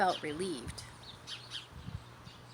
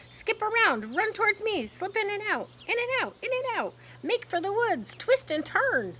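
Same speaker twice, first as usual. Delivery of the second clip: goose crying out; 11 semitones higher than usual. A narrator's reading. Goose shouting orders to Wilbur, high and excited. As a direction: urge the pig on in a high honking cry. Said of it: "cried the goose".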